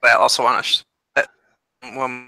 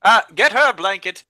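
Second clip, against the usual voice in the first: commanding call; 8 semitones higher, 7 dB louder than usual. Acted. Royal orders servant.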